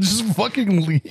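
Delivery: ordinary talk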